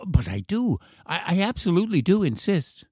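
put-on high, stammering child; insisting boy. A narrator's reading. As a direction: insist eagerly as a little boy.

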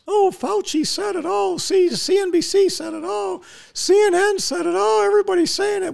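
very high, silly parroting voice; mocking the followers. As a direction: parrot every authority in a squeaky mock voice. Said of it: "comporting to whatever anybody says".

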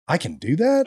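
high, incredulous disbelief; stunned that it's even allowed; surprised. Retold or imitated. Retelling John's advice, amazed disbelief. Disbelief at being told build substation.

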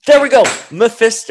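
loud, high triumphant exclaim; excited. Retold or imitated. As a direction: call it out like a big reveal. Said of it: "I was like".

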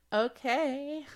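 giddy okay and a high laugh. Real, not acted. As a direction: bubble over into a laugh. Snapping out of meditation.

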